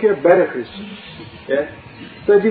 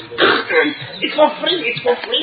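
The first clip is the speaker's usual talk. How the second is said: much higher, very slow, sing-song taunt; mocking demon; 8 semitones higher, much slower than usual. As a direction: drag it out like a sly trickster. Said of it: "and he goes".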